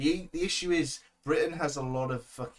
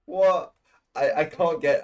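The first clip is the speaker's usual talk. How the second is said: loud, higher "What?" in disbelief; surprised outburst; 5 semitones higher, 9 dB louder than usual. Real, not acted. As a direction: blurt it out, caught off guard. Hearing the name Didi.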